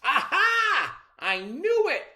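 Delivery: very high, loud triumphant shout; father catching on, roaring in triumph. A narrator's reading. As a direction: explode with I-knew-it glee. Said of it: "roared Mister Man".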